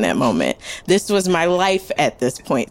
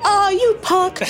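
high, playful jab; lightly voiced celebrity teasing; high teasing Paul Rudd; 12 semitones higher, slower than usual. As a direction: tease her fondly.

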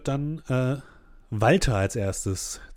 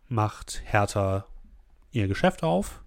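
ordinary talk, nothing marked

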